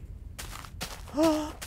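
sharp delighted gasp at a kitty; excited gasp. Real, not acted. Spotting a kitty, a high excited gasp. Spots a cat in game.

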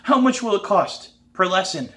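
high, loud, squeaky question; excited squeak. A narrator's reading. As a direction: let excitement push the voice up into a squeak. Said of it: "my voice squeaks a little".

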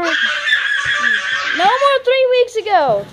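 high, loud, stretched-out yell; long laughing holler; playful shout. Real, not acted. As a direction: shout it slowly through laughter. Playful protest amid laughter.

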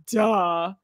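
dismissive duh, voice changed; a bored teenage duh; mocking duh. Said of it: "I'm like".

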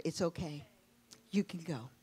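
soft, hushed letting go; tender release. Retold or imitated. Mother telling her dying daughter she can go, softly. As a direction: gently tell her she may go.

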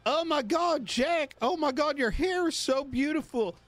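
hammed-up gossipy complaining voice; disgruntled employee. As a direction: whine like a catty, jealous coworker. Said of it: "I'm gonna pretend I'm a, you know, disgruntled employee".

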